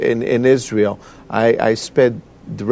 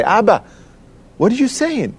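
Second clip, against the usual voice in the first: retold astonishment; 8 semitones higher than usual. Acts out astonished question to father.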